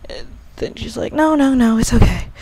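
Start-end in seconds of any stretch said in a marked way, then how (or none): 1.1-2.3 higher, louder "no, no, no"; acted-out protest, pitched up; insistent reassurance. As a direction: play her quick to insist all is okay. Character's hurried denial in retold scene.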